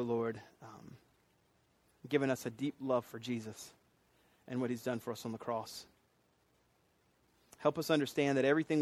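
soft, low, reverent praying voice; prayer. Real, not acted. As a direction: pray quietly with unhurried pauses. Leads the congregation in prayer.

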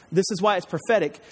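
normal talking voice

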